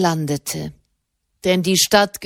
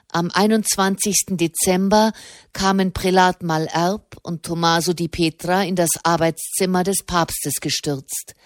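plain reading voice

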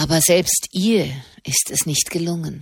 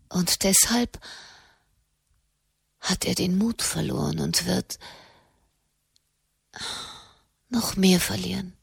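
half-whispered. Acted. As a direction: let it fade into a whisper. Katharina, leise Klage über Papst. (halb geflüstert, bang)

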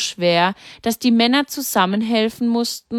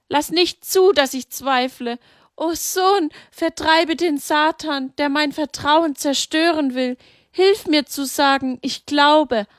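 higher, pleading prayer to her son; grieving mother praying; 7 semitones higher than usual. A narrator's reading. Mary prays to her dead son, high and pleading. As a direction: beg him to keep her from doubting.

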